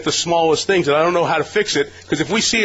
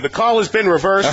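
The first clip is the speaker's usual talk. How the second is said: mock referee announcement, pitched up; Jeff Triplett; 6 semitones higher than usual. Alarm app announcing a reversed call, mock referee voice. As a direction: call it out like a ref on the stadium mic. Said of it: "it was Jeff Triplett saying".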